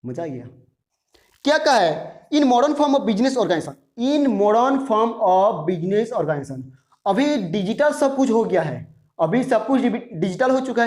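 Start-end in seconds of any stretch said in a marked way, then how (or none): 4.0-6.6 slow, separated words; measured word-by-word reading; slow dictation. Shown as a demonstration. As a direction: repeat it slowly for writing down. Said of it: "थोड़ा slowly बोल रहा हूँ".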